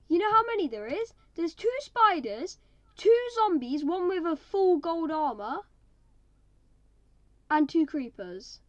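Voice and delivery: loud, high, frantic counting; panicked. Real, not acted. Player counting swarming monsters to viewers, panicked loud. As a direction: rattle them off in loud alarm.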